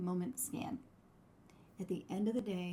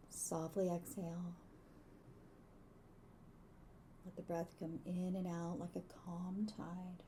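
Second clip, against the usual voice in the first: soft spa whisper; 7 dB quieter than usual. Acted. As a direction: ease them into calm, softly. Leads a gratitude meditation.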